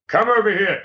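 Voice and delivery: high-pitched, theatrical game host voice; Dark Room host. Shown as a demonstration. Comedian demonstrating how Dark Room works, theatrical host voice. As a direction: call it out high and showy. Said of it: "Dark Room works like this".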